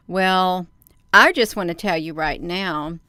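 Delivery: ordinary talk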